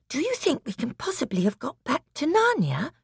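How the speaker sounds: put-on high, hushed child's wonder; wondering exclamation. Lucy asking Peter if it's Narnia, wondering exclamation. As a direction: ask with awed hope. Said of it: "exclaimed Lucy".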